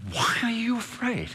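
soft, high-pitched question; puzzled, lifting the voice softly; gentle incredulity. Acted. God asking the fearful after a promise, gentle incredulity.

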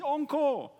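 very high-pitched mock crowd call; imitating a shouted encore. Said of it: "and they shouted".

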